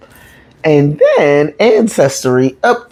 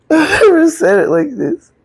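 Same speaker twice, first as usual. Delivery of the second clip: a teary laugh breaking over the words, much higher; laughing through tears. Real, not acted. Admitting to the audience she never told it this way, laughing through tears. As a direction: let the emotion crack into a laugh. Repeats the line, overcome.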